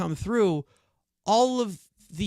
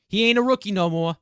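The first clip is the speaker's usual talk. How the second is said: quick, louder New York accent on "ain't"; 3 semitones higher, 5 dB louder, faster than usual. Shown as a demonstration. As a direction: punch "ain't" with a streetwise New York twang. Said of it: "I don't know where the New York accent came from".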